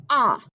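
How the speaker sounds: ah, the short a sound of octopus, open and clipped. Shown as a demonstration. Teacher modelling the short vowel ah for octopus. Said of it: "so I'd say".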